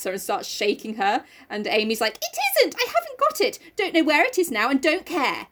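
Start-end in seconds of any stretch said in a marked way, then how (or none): 2.2-5.5 high-pitched bratty denial; squeaky, hammed-up defiance; mock defiant protest. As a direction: protest like a cornered little sister. Amy being shaken, denying it.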